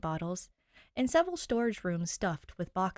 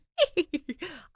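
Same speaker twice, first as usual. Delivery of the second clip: giggle; 9 semitones higher, 5 dB louder than usual. Real, not acted. Laughs at own spooky voice.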